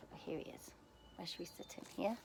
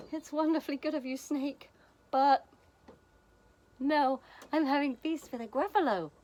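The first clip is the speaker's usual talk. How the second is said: high, louder little mouse voice; polite mouse; 7 semitones higher, 6 dB louder than usual. Acted. Mouse turns down snake's feast, high and loud.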